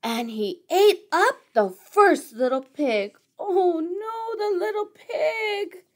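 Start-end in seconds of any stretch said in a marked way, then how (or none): 3.4-5.8 mock lament. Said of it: "reader's own mock lament".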